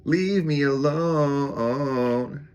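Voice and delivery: high, very slow, stretched-out leave me alone; playful exaggerated plea to be left alone; drawn-out mock wail. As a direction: whine it out long and high like a ghost. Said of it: "I'm like".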